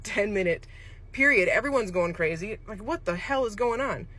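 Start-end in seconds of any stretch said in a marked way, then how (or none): none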